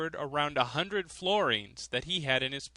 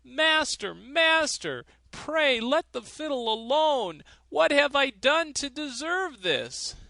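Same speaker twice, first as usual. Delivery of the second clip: much higher, louder desperate begging; miser pleading; 11 semitones higher, 5 dB louder than usual. A narrator's reading. Miser begging the countryman to stop playing, much higher and louder. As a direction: plead in panic to stop the fiddle. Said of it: "cried the miser".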